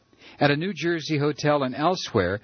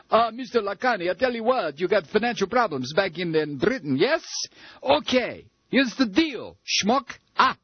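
put-on high, pushy Yiddish-accented salesman; hammed-up Yiddish accent, wheedling; 6 semitones higher than usual. Mock agent offering the deal.

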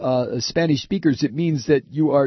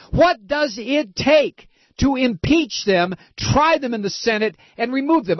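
furious rant; 8 semitones higher than usual. Real, not acted. Host raging about impeachment, furious high rant. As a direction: hammer each step with fury.

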